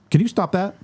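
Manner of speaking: acting out telling someone off; retold confrontation. Retold confrontation with flashing uncle.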